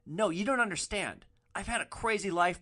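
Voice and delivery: mock a self-important passenger insisting he is misunderstood; boastful drunk passenger. Drunk passenger brags, acted.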